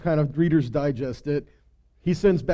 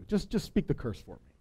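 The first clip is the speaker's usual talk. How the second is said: much softer, coaxing; hushed pleading; 9 dB quieter than usual. Retold or imitated. King quietly coaxing Balaam to speak the curse. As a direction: lean in and wheedle quietly.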